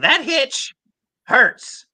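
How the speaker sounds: high strained voice with a catch; Usopp's hitch. Shown as a demonstration. Voice actor demonstrates Usopp's hitchy voice, lightly strained. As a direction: squeeze a catch into the voice. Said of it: "with a hitch in his voice".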